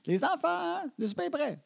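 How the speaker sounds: high, singled-out dinner call, mimicked. Mother calls kids to supper, high mock holler. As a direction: imitate a parent calling through the house. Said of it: "Elle crie".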